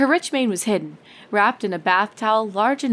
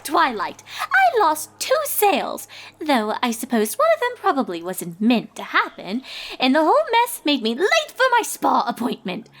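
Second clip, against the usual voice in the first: dramatic lament, swooping much higher; high, theatrical Rarity; 7 semitones higher than usual. Acted. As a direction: play up the tragedy like a diva.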